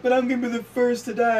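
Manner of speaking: high, loud imitation of panic; mock panic. Stoned person convinced of dying, mock panicked protest. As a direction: wail it as a stoned worrier, hammed up.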